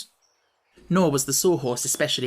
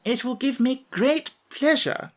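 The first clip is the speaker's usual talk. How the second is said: higher, slow and courtly insect; gracious bow; 10 semitones higher than usual. A narrator's reading. Wogglebug accepting the Tin Woodman's invitation, slow and courtly. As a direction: unfold it with profound grace. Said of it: "bowed with profound grace".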